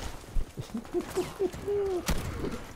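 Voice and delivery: sharp high burst of laughter. Real, not acted. Laughing at opponent.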